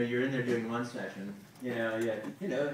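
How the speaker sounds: ordinary talk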